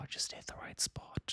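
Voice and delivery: hushed, savoring whisper. Real, not acted. Raving about greasy meat, whispered. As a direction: whisper it like a secret pleasure.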